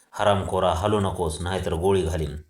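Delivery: plain reading voice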